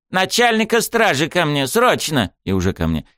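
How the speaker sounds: high, urgent pompous mayor; bossy mayor voice. A narrator's reading. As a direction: order the staff about as the mayor.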